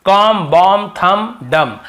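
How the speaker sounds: कॉम, बॉम, थंब, डम each ending on m; silent b. Shown as a demonstration. Teacher recapping the rule, कॉम, बॉम, थंब, डम with b silent. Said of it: "एम के बाद बी का उच्चारण".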